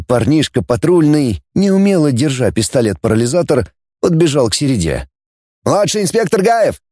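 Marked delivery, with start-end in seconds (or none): 5.6-6.8 put-on high young patrolman's voice; high-pitched junior officer reporting; eager shouted report. A narrator's reading. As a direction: call out your rank and name, high. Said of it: "выкрикнул он".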